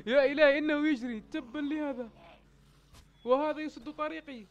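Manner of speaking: high-pitched alarm at being chased; urgent, high exclamations. Real, not acted. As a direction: cry out as you are cornered. Zombie runs at him, path blocked.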